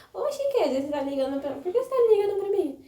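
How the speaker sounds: mock her startled, high-pitched reply; surprised mom. Imitating her surprised mother on the phone, high and puzzled. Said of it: "minha mãe falou assim".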